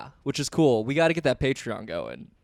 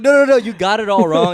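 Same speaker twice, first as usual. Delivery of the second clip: excited protest; 9 semitones higher, 10 dB louder than usual. Retold or imitated. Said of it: "And I was like".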